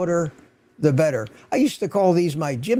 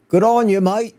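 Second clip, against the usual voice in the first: a quick, hammed-up Australian accent, higher and louder; 7 semitones higher, 7 dB louder than usual. Greeting a viewer in Sydney in a mock Australian accent. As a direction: toss off a bad Aussie "good on you, mate". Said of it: "about the best you're gonna get out of me in an Australian accent".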